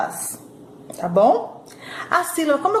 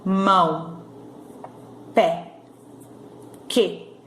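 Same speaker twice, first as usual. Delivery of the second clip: "Mão", "Pé", "Que" said lower, each alone between pauses; one sound each; 4 semitones lower, 4 dB quieter, much slower than usual. Shown as a demonstration. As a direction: give "Mão", "Pé", "Que" as single separate sounds. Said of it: "Quantas vezes eu abro a boquinha". ("Mão", "Pé", "Que" num som só, bem separados)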